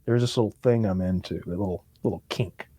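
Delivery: hushed sheepish admission; he's like. Retold or imitated. Mocking the hero's kink line, soft low confession. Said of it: "he's like".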